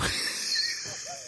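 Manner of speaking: real breathy laugh, airy and high; soft real laugh. Real, not acted. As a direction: burst out laughing at the story. Laughter after quote.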